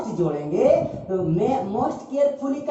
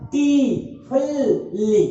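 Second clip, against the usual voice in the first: टी, फुल, ली each said alone and held; slow count-out of टी, फुल, ली with gaps; syllable by syllable; 2 semitones higher, slower than usual. Shown as a demonstration. Teacher proving four syllables, टी, फुल, ली separated. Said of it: "इसको तोड़ के देखिए".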